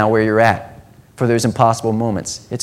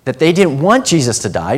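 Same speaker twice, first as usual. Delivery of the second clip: ordinary talk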